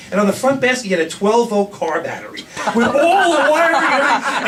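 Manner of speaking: high, loud laughing talk. Real, not acted. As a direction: laugh through the telling. Laughing at the memory.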